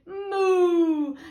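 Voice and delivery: loud, high, drawn-out moo; cow's moo. A narrator's reading. Cow celebrating after the thieves are caught, loud moo. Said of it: "the cow said".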